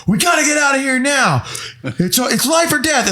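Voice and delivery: high, urgent melodrama; hammed-up auditioning actor, high-pitched. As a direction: overact it like a life-or-death screen test. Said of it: "the guy doing the audition's like".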